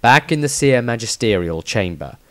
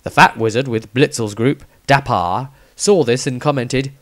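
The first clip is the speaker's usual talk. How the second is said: ordinary delivery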